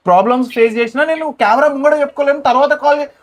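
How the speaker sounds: heated high-pitched outcry; upset shouting. Real, not acted. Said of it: "ఏదైతే అరుస్తున్నావో".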